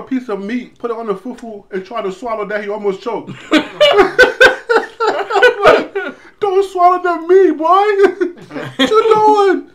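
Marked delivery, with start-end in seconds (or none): 3.3-6.4 big high burst of laughter; loud laugh. Real, not acted. Boy nearly chokes on meat. 6.4-9.7 high, loud laughing scold. Real, not acted. Laughing scold at choking boy.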